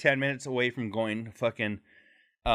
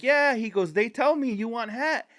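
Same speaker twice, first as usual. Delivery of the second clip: joking hustler; 10 semitones higher than usual. Retold or imitated. Hustler joking with the line, high playful voice. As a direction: tease it up high. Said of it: "he was like".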